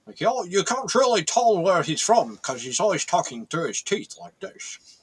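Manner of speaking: imitating a Scottish voice squeezed through teeth; Sean Connery through gritted teeth. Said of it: "so he sounds like this".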